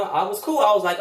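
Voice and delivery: ordinary talk, nothing marked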